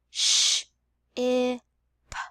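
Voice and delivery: "Sh, I, p" separated by short pauses; sounded out. Shown as a demonstration. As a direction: tap out "Sh, I, p" sound by sound. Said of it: "listen for that last sound".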